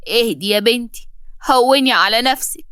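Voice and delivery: plain reading voice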